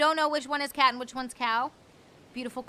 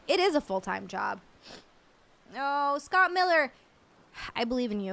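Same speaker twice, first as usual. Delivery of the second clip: usual speaking voice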